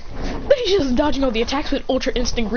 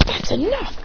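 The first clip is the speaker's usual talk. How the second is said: higher-pitched command to stop; stern intervention; 8 semitones higher than usual. Acted. Naruto appearing in front to intervene, raised-pitch command. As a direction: cut in with a raised voice.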